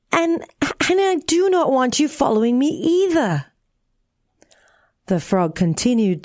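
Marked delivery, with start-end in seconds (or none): 0.1-3.5 put-on higher-pitched, faltering girl's protest; lightly voiced tearful child, pitched up. A narrator's reading. Tearful refusing the frog that follows her, high protest. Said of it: "said Tearful".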